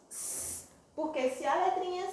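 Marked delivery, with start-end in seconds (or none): 0.1-0.7 S as a long soft hiss; S sound. Shown as a demonstration. Teacher showing children a letter sound, S as a soft hiss. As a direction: hold S as a hissing consonant, no vowel. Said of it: "tem dois sons".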